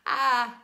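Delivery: sudden loud surprised cry; startled yelp. Real, not acted.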